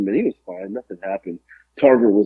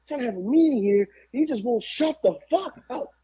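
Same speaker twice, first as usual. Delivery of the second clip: high caricature grumble; exasperated tough guy, pitched way up; mock New Jack; 13 semitones higher than usual. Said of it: "He just kept going".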